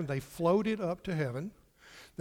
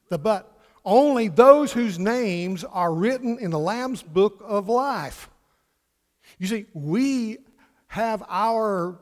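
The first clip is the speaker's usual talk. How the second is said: loud, raised preacher's voice, slowed for weight; emphatic preaching; 5 semitones higher, 8 dB louder, slower than usual. Real, not acted. Preacher assuring congregation their sin is dealt with, emphatic preaching. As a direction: drive the good news home to the congregation.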